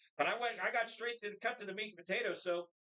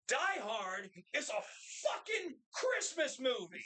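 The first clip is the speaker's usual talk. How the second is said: slow build, "fucking" punched high; emphatic declaration; 4 semitones higher, slower than usual. Retold or imitated. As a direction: draw it out, hitting "fucking Christmas movie" hard and high. Retells roast's emphatic closing declaration.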